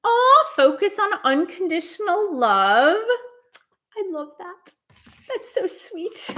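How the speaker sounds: real tender delight. Real, not acted. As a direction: coo over a sweet card reading. Delighted by the card she drew.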